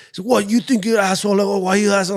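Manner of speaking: high, put-on angry voice; mocking rival imitation. Fighter mimicking the drunk rival who cut in line, high mocking voice.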